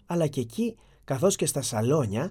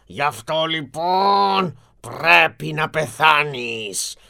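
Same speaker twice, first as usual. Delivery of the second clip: slow, menacing beast voice rising to a loud threat; frightening monster; 3 dB louder, slower than usual. Acted. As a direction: draw it out like a beast passing sentence. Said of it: "είπε το τέρας με φωνή τρομακτική".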